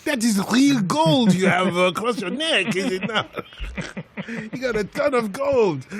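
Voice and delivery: high, slow South American accent put on for teasing; exaggerated South American English. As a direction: tease him in a mock South American accent. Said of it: "so I could do my South American".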